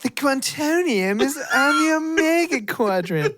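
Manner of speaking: high, drawn-out sensual robot; over-the-top robot voice. Retold or imitated. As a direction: ham up a sultry computer announcement up high. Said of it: "her voice is so over the top".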